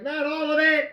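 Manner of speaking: high, hammed-up protest; mock protest. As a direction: yelp it in playful alarm. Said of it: "I'm like".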